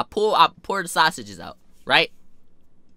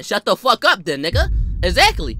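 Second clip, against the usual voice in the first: heated retort; 4 semitones higher, 6 dB louder than usual. Retold or imitated.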